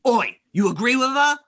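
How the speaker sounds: high, pushy, confrontational demand; challenging churchgoer. Acted. Churchgoer rounding on the player, hostile challenge. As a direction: square up to the stranger, daring him to answer. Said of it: "he looks at you".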